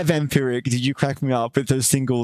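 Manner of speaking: normal talking voice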